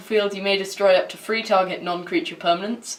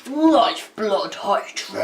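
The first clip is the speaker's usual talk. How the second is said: big announcer voice; much slower than usual. Acted.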